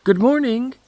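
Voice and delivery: put-on high, loud girlish call; Little Red Cap calling into the house. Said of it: "She called out".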